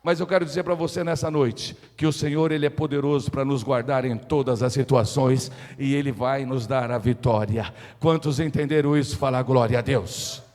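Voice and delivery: solemn, forceful preaching. Real, not acted. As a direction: proclaim assurance in a low, powerful voice.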